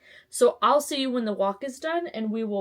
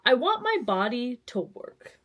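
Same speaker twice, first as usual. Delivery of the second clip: ordinary delivery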